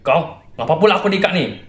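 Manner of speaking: usual speaking voice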